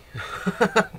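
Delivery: high, loud short laugh; quick burst of real laughter. Real, not acted. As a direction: chuckle at yourself out loud. Laughing about himself.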